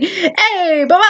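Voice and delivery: put-on high playful kid's call; toddler shouting. Toddler hailing dad across the stand, high playful call. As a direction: squeal it like the toddler. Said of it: "shouts out".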